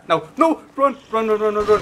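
high, loud frantic yelling; panicked shouting. Real, not acted. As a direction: yell run over and over in panic. Chased by enemy.

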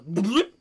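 loud, high cartoon bloop; silly bloop sound. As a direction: pop it like a cartoon. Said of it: "his face goes".

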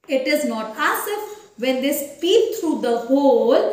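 usual speaking voice

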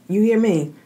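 ordinary talk

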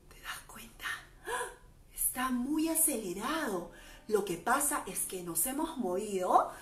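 quiet, gentle guidance; soft voice. Real, not acted. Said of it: "guiding kids softly". (voz suave y tranquila)

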